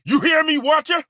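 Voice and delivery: defiant outburst at the Watcher; angry. Acted. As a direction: refuse him hotly, near shouting. Angry companion refusing betrayal.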